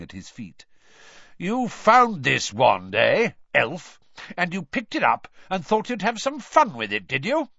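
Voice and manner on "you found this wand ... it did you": loud, rising interrogation of a suspect; hardened accusation. A narrator's reading. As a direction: press the accusation hard, pitch climbing. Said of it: "his eyes hardening".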